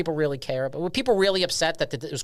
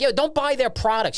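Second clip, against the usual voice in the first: mock outraged boycotter; 10 semitones higher than usual. Mocking boycotters.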